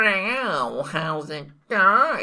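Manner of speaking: slow, mewing cat voice; cat person voice. Retold or imitated. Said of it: "they'll be like".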